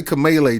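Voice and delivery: "Kamala" said kuh-MAY-lay on purpose; provoking "Kamala" as kuh-MAY-lay; deliberate Kamala. Shown as a demonstration. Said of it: "just to piss y'all off".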